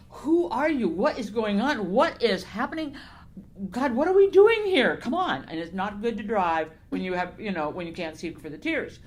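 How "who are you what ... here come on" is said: high, loud, pleading demands, retold; distraught, exasperated questioning. Author recalling her tearful drive, questioning God in loud exasperation. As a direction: re-enact bewildered questions flung up at God. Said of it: "I'm crying and thinking".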